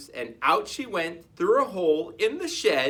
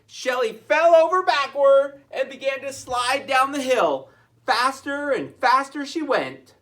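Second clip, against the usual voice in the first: louder, higher, building thrill; excited narration; 9 semitones higher, 6 dB louder than usual. A narrator's reading. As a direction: build excitement as she speeds. Sliding faster and faster.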